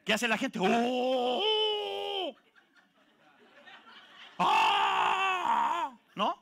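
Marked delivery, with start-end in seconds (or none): 0.6-2.4 mock startled gasp of fright; high mock gasp; startled cry. Congregation startled by healing. 4.4-6.0 high startled cry; high hammed-up shriek; mock scream. Congregation startled by healing.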